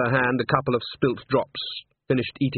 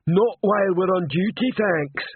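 high, raised troll voice; troll piping up over usual level; 8 semitones higher, 4 dB louder than usual. A narrator's reading. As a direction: answer as a dutiful troll, pitched up. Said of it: "said the troll".

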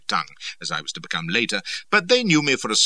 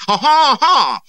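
high, loud haughty laugh; braying laugh; 13 dB louder, slower than usual. A narrator's reading. Cardigan greets someone with his haw-haw laugh. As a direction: bray it like a proud lord. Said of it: "I heard his".

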